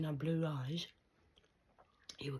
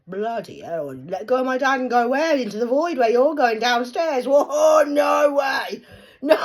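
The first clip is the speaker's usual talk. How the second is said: much louder and higher furious yelling; outraged shout; 8 semitones higher, 16 dB louder than usual. Real, not acted. Refusing to be sent to the void, shouting. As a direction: explode in disbelief.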